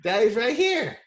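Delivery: high, gentle reassurance. Real, not acted. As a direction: soothe the little one. Father reassuring his small child.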